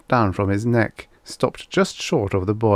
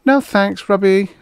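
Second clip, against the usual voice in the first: high, yawned refusal; young woman's bored, high-pitched yawn; 12 semitones higher, 5 dB louder than usual. A narrator's reading. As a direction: turn it down through a yawn. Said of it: "the young woman yawned".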